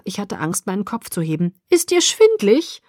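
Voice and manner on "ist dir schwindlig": concerned. A narrator's reading. Worried teacher asks faint student.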